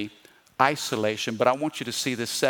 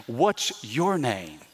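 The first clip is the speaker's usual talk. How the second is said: slow, lower question weighted on "your"; stress on YOUR; 4 semitones lower, 3 dB quieter, much slower than usual. Acted. Jesus flipping the script on the spirit, "your" weighted slowly. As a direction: lean slowly on "your", pitch dropping.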